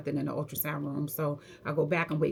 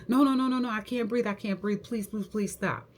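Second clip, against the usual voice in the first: re-enacted high, desperate begging; panicked; 5 semitones higher than usual. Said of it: "I start hyperventilating".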